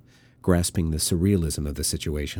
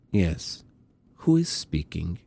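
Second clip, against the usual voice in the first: put-on Russian accent; slower than usual. A narrator's reading. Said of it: "The accent was Russian".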